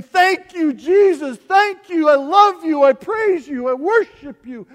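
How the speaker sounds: high, loud shouted thanks; shouted praise. Acted. Preacher shouting thanks to Jesus as the congregation's praise. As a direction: burst out in joyful praise. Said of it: "how can we not just sing".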